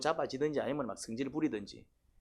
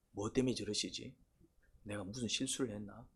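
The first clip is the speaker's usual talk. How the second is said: soft, lower musing to oneself; worried self-questioning; 4 semitones lower, 10 dB quieter than usual. Acted. Servant puzzling over the displeased king, quiet self-questioning. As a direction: wonder quietly what you did wrong. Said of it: "왕이 뭐 때문에 저렇게 마음이 상했을까".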